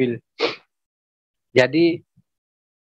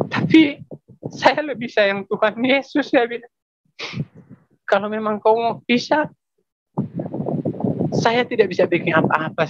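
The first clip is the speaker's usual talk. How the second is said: higher-pitched, emotion welling up; choked with emotion; 6 semitones higher than usual. Real, not acted. Husband tells wife he loves Jesus more, choked with emotion. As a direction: voice rising as feeling overwhelms you.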